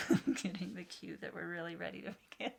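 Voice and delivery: laughing through the words; quick, amused talk broken by laughter. Real, not acted. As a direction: let real giggles run through it. Dog interrupts as she starts.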